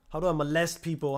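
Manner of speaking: normal talking voice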